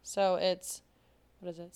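usual speaking voice

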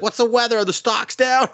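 high-pitched imitation of a kid asking questions; a small child chirping questions; squeaky kid voice. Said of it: "She's like".

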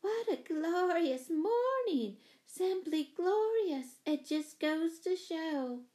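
high, slow, drawn-out rapture; lofty singing-out of delight; exclaimed delight. A narrator's reading. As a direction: gush it grandly, stretching every word.

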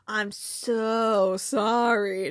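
drawn-out, oversweet fake apology; condescending. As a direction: imitate a condescending counselor, stretching every word slowly. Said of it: "he always felt so condescending".

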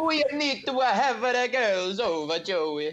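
high, slow Italian nonna voice; Italian grandma accent. Retold or imitated. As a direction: sing it like an old Italian grandma. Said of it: "It's pretty fucking close".

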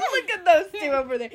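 laughing mock onlooker; laughing retold passersby. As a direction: giggle out the onlookers' line.

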